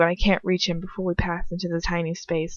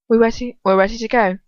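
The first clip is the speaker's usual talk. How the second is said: said shortly; 4 semitones higher, 5 dB louder than usual. Acted. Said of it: "she says shortly".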